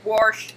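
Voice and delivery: "wash" as "warsh"; accented "wash". Shown as a demonstration. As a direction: quote yourself saying "wash" with an added R. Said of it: "in my lovely, horrible accent".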